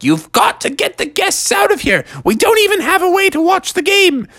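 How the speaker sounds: high, urgent mom voice; fed-up falsetto order; exasperated mother. Acted. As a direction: insist in a high-pitched complaint. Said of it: "Mother's like".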